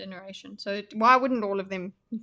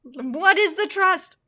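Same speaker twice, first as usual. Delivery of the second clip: high, loud, slow mock voice; lofty mock voice; 9 semitones higher, 8 dB louder, slower than usual. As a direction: ham up a grand question. Mock-voicing a character's question.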